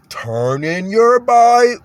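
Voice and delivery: over-careful, slow talking down; Stephen Lett. Acted. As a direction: instruct slow listeners with stretched-out words. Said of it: "talk a certain way".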